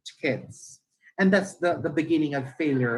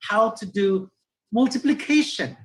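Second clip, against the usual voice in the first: higher-pitched teacher addressing a class; conversational teacher; 5 semitones higher, 3 dB louder than usual. Shown as a demonstration. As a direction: chat to pupils as you introduce the lesson. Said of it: "para ka lang nakipag-usap".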